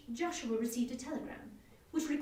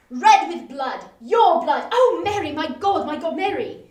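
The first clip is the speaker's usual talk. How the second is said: much louder, rising horrified outcry; a husband crying out in horror as she faints; anguished cry; 3 semitones higher, 16 dB louder than usual. Acted. Climax of acted horror.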